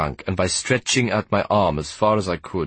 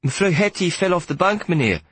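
put-on much higher, small scared boy's voice; young boy frightened by the stern conductor; 10 semitones higher than usual. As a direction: answer timidly, afraid of trouble. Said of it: "I answered in a frightened voice".

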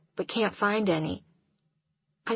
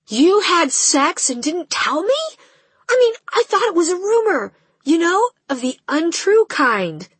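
shocked; 9 semitones higher, 6 dB louder than usual. Acted. Shocked friend reacts.

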